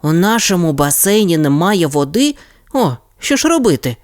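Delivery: rising, high panicked question; a child losing his nerve; Alex panics about the empty pool; panicky boy. Acted. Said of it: "запанікував Алекс".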